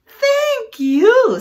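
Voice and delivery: high, loud little leaf voice. Acted. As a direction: chirp it up high and bright. The leaf thanks ladybugs.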